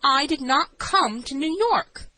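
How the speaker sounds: slow, high, insistent denial; incredulous. A narrator's reading. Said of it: "looking as incredulous as I thought a crazy person could".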